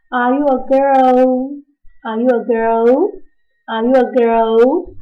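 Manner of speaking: put-on slow, with the pitch rising on "girl"; teacher modelling a rising "Are you a girl?"; rising question. Shown as a demonstration. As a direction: ask it slowly, going up at the end. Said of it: "怎么问".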